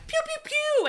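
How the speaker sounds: high, loud mock laser shots going pew pew pew; gunshot noises. Dean blasting away as he runs, mock gunfire. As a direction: fire imaginary guns with your mouth. American flag, he shoots.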